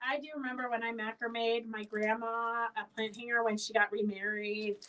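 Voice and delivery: ordinary talk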